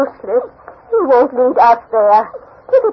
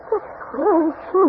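tearful; 3 semitones higher, 7 dB quieter than usual. Acted. Searching for the lost girl.

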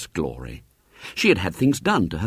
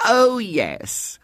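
Mrs Bott voiced; 13 semitones higher than usual. A narrator's reading. Mrs Bott answers the manageress.

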